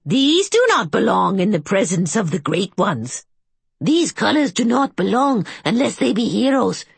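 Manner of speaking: high, fretful voice of a crypt keeper; a jittery old keeper guarding the dead; nervous old caretaker. A narrator's reading. Agamon's voice in the story.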